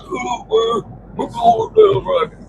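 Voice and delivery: high, hooting mockery of the guards; mocking holler. Guards hollering at the soaked bunk, in a high mocking hoot. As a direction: hoot it out high like teasing jailers. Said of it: "They hollered".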